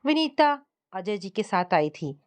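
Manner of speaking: plain reading voice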